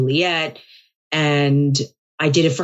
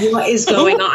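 laughing; 5 semitones higher, 5 dB louder than usual. Real, not acted. Recalling headlines, laughing.

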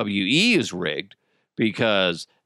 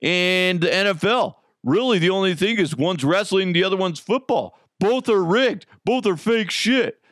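mocking conspiracy believers; 6 semitones higher than usual. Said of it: "There's a lot of people going".

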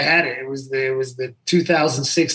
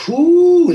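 big whew; 8 dB louder than usual. Real, not acted. Asked about favorite boxer.

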